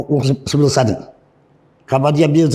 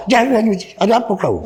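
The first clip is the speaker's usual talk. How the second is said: high, raised voice of a cross manager; manager's mock scolding, pitched up high; retold angry manager; 9 semitones higher than usual. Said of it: "manajer marah".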